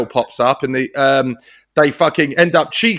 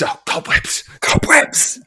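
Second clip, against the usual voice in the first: quoted film line; 5 semitones higher, slower than usual. Retold or imitated. Quoting film character's line.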